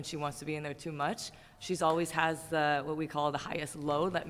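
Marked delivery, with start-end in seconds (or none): none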